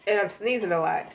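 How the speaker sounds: normal talking voice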